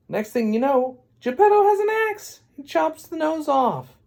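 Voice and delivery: high-pitched, excited outburst; worked-up telling. Acted. Storyteller telling of Geppetto with the axe, high excited outburst. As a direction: build to a squealed climax.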